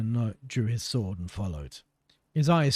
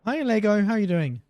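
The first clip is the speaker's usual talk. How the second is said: high, loud teasing greeting; mocking captain; 9 semitones higher, 4 dB louder than usual. Pirate captain mocking the guard, high teasing voice. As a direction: tease him sweetly.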